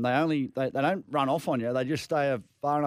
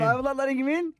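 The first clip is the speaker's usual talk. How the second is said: incredulous; 12 semitones higher, 3 dB louder, faster than usual. Real, not acted. Co-host protesting the host won't let the caller in, incredulous high squeak. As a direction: pitch leaps high in disbelief.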